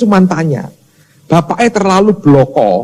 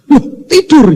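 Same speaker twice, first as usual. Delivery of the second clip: high-pitched retold disbelief; acted astonishment; 10 semitones higher, 4 dB louder than usual. Father finds his son asleep mid-trip, high mock astonishment. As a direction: exclaim it as if just discovering it.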